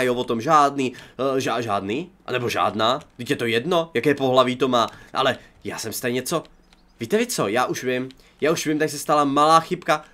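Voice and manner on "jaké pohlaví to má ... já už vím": ordinary talk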